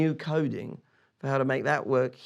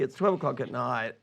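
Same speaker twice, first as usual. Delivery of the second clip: ordinary talk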